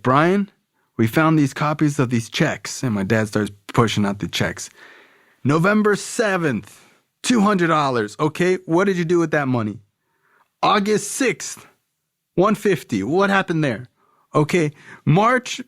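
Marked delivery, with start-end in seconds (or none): 0.0-2.8 high-pitched, stern dad confronting his son; real serious dad. Retold or imitated. Dad confronting his son over copied checks, real serious, pitched high. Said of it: "my dad's real serious". 5.4-15.6 much higher-pitched imitation of an interrogating dad; real serious dad. Dad going through checks with his son, insistent and high-pitched. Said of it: "my dad's real serious".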